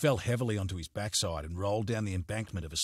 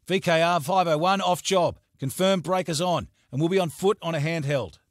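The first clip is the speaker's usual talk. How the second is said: high, loud, urgent call into the handset; urgent radio call; 8 semitones higher, 9 dB louder than usual. Acted. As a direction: report in fast and urgent. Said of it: "spoke into it with urgency".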